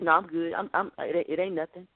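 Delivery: husband brushing it off. Retold or imitated. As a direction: mumble it away like a sulking husband. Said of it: "He's like".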